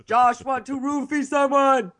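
put-on brute voice with caveman phrasing; mock monster talk, slowing down at the end; Hulk. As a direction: lumber through it like an angry monster. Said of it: "Like Hulk".

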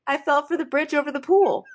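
high, gushing confession; hammed-up earnest declaration of love; mock lovesick woman. Imitating the woman's flighty confession.

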